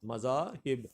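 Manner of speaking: مذاہب as "ma-zaa ... hib", falling; correct pronunciation. Shown as a demonstration. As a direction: say مذاہب in clear parts, ending "hib". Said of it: "یہ درست ہوگا".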